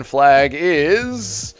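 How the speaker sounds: drawn-out build to the answer; slow dramatic reveal. Acted. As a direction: stretch it out like a game-show reveal.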